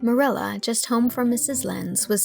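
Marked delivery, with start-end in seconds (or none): none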